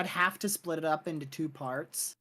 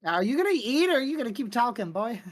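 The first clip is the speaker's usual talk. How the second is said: teasing; 8 semitones higher, 4 dB louder than usual. Real, not acted. Teasing friend who keeps eating.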